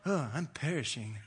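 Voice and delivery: mock-dramatic British accent; hammed-up British sufferer. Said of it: "In a British accent, probably".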